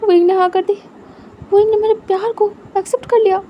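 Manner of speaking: high, loud excited cheer; overjoyed shout of yes; jumping for joy. A narrator's reading. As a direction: burst out with giddy joy. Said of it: "उछल पड़ता है".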